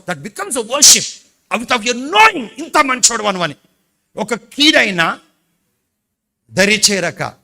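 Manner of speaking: high, loud, impassioned sermon cry; preaching at full force. Acted. Pastor stirs the congregation to worship, loud impassioned preaching. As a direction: lift the hall with it.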